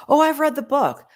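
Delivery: high, bright-eyed boast; mock a know-it-all who read the book; proud reader who thinks they know it all; smug know-it-all. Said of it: "people say to me".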